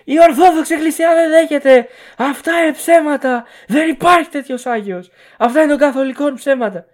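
high, loud rant of an outraged zealot; hammed-up imitation of a screaming believer; mock screaming. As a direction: yell it like a fanatic denouncing lies. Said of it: "Άσε να ουρλιάζει".

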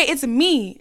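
ordinary talk

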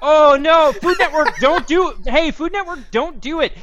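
high, urgent begging; panicked plea to Food Network; frantic plea. Real, not acted. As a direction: beg them to stop.

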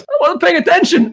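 put-on dopey drunk buddy. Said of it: "He goes".

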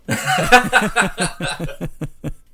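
loud, high burst of laughter. Real, not acted. Podcast host amused by his co-host, loud high laughter. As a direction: crack up laughing for a couple of seconds. Cracking up at joke.

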